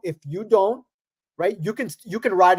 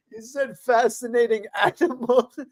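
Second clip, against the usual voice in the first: talking through laughter, pitched up; laughing; 4 semitones higher than usual. Real, not acted. Co-host repeating his friend's funny phrase, laughing. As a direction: crack up while repeating the phrase.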